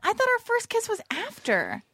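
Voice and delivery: high-pitched, astonished outburst; flustered surprise, voice way up high; shocked disbelief. Real, not acted. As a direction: blurt it out, stunned by the news. Shocked to learn of forgotten kiss.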